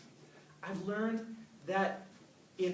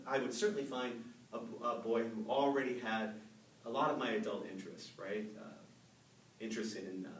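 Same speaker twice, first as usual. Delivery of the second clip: ordinary talk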